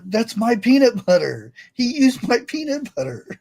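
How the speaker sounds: a hint of a kid tattling, pitch up; lightly voiced child protesting her peanut butter; indignant little girl. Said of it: "And the little girl's down there".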